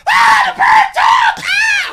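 hammed-up falsetto shriek; girls' shocked scream. Girls startled by a talking bird, shrieking. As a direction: scream it very high and loud. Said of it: "And then they go".

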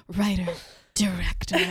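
imitating a sultry mic whisper; mock breathy whisper of the credits. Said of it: "She was, like, whispering into the mic".

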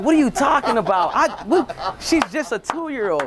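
high, laughing "what are you talking about"; wife, laughing in disbelief. Retold or imitated. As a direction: laugh it off like she has no idea. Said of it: "And she's like".

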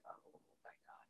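breathless whisper of oh my God; whispered dread. Retold or imitated. Investors dreading the mentor in the elevator, hushed whisper. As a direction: whisper it like you can't believe it.

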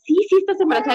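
high-pitched imitation of an excited cousin; excited confirmation. Doctor cousin confirms pregnancy, acted out.

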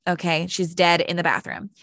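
ordinary talk

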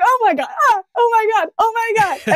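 high, loud panicked oh my Gods; mock panicked screaming. Friends in a chaotic boss fight, panicked high shrieks. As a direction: reenact the frantic scramble, voice up high. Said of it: "screaming and running around".

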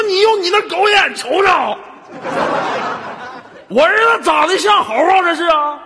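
high, loud indignant shouting; hammed-up outrage of an insulted father; retold angry outburst. Father telling off stranger who mocked son, angry shout. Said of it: "我当时我就生气了吗".

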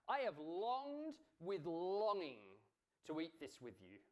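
"longed with longing" drawn out slowly and loudly; slow, forceful weight on "longed with longing"; heavy emphasis. Retold or imitated. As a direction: stretch both "longed" and "longing", then ease off. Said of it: "emphasizes the verb by using the noun form".

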